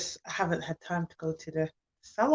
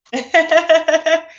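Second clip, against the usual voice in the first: loud happy laughter; real joyful laugh; delighted laugh; 7 semitones higher, 9 dB louder than usual. Real, not acted. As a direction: laugh with relief and glee. After announcing winner.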